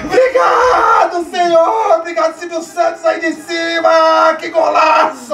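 euphoric tearful shout; shouting thanks in joy; crying out thanks. Real, not acted. Fan celebrating goal, crying out.

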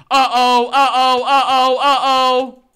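repeated loud alarmed uh-oh. Real, not acted. Warning the team as enemies flank, loud alarm. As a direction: chant the alarm as they close in.

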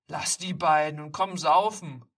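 loud call across the park; loud buddy calling from a distance. Acted. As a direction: shout to him to come drinking. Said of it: "Plötzlich ruft jemand".

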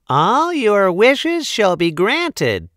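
high, slow sparkly fairy call; tiny tinkling fairy voice. Acted. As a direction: announce it like a tiny magic fairy. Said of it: "cried the fairy".

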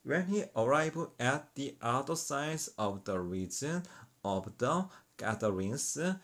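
slow, careful read of the English verse, word by word; slow teaching read. Shown as a demonstration. Teacher reading the verse slowly to Korean learners, deliberate and slow.

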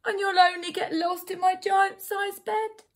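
high storybook wail; bawling bear. A narrator's reading. Bear worries over its tiny teddy, mock bawling. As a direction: keep up the bear's acted bawl. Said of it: "the voice bawl".

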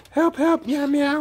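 high-pitched pleading cat voice; kitty calling for help. Pretend. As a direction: cry out and mew like a stranded kitty. Kitty in trouble calls out.

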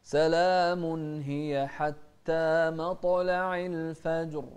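slow tajweed chanting; Quran recitation. Shown as a demonstration. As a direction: stretch سلام long and ring out the verse. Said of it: "شوف القلقلة".

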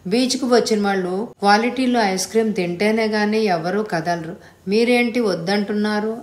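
usual speaking voice

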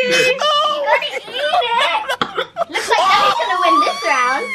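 high laughing shout; laughing teasing. Real, not acted. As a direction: cackle out the tease, gleeful. Teasing dad laughing at kids.

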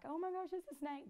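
high-pitched alarmed outburst; teacher spotting a snake, high and alarmed; panicked exclamation. Retold or imitated. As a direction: blurt it out in a fright. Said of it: "scare 'em by being like".

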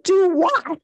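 high-pitched, challenging retelling of a comeback; indignant retort. Retold or imitated. Retells arguing back in the hallway.

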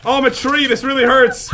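loud, high mock-pained wail; hammed-up distress at a game mishap; comic panic. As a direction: cry out in playful agony. Player burning while morphed as tree.

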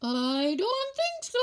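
high doubtful fish voice; a little fish, unsure. Acted. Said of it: "suggested Fish".

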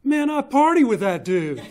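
loud, high burst of approval; imitated delighted bully. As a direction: exclaim it like a suddenly impressed tough kid. Bully suddenly turns friendly, punchline.

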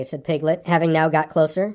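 plain reading voice

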